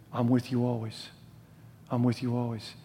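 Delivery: soft, low and tender; gentle reassurance. Acted. Preacher voicing God's promise to his people, softly reassuring. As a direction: drop down into a quiet, comforting promise.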